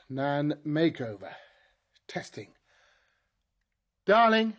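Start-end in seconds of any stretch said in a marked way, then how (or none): 4.1-4.5 calls out darling, loud and commanding. Acted. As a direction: summon her with one sharp loud word. Calling robot wife to attention.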